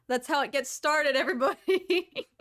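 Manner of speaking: playful announcement dissolving into laughter; joking, breaking into laughter. Real, not acted. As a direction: let the joke tip into a laugh. Joking about being fan cast.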